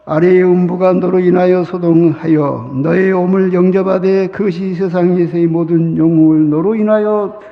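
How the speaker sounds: loud, measured recitation of scripture; a solemn public bible reading. Acted. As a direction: read the verse with solemn weight. Reads scripture aloud.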